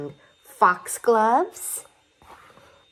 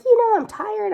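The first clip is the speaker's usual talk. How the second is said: caterpillar voice; 4 dB louder than usual. Acted. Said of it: "he's like".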